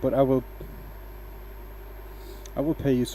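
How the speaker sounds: ordinary talk, nothing marked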